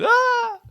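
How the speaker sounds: short, very high shriek; a hammed-up high scream; mock scream. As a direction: squeal like a scared passer-by.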